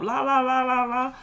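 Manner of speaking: high, monotone la-la babble; mock cursing-out. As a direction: imitate her ranting as high nonsense syllables. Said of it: "she going off".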